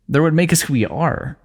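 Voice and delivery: ordinary talk, nothing marked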